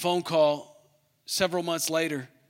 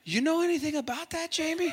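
high, knowing question to the preacher; teasing host; 8 semitones higher than usual. Retold or imitated. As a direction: pitch the host's voice up, probing. Host quoting the Bible, testing.

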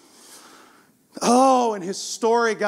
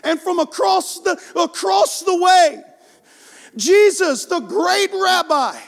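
higher, louder storytelling preacher voice, stretched words; rising preaching climax; 8 semitones higher, 4 dB louder, slower than usual. Acted. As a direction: build the moment toward Jesus' arrival.

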